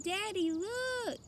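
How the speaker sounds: high, slow excited call; little girl calling her daddy; excited child. A narrator's reading. Taya calls her father to look, excited.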